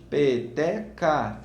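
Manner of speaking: Peteca slowly split pe-te-ca; syllables split. Shown as a demonstration. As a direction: count out Peteca in three slow beats. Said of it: "Três pedacinhos".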